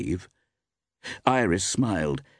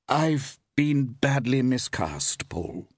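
slow, higher, unconvincing excuse; strangely unconvincing; 7 semitones higher, slower than usual. A narrator's reading. Actress giving her excuse after a pause, unconvincing. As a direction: offer the excuse slowly, without conviction. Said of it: "her tone was strangely unconvincing".

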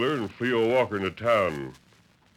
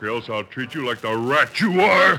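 angry shout; 3 semitones higher, 6 dB louder than usual. Acted. Lone Ranger threatens Wheeler.

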